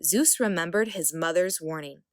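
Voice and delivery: usual speaking voice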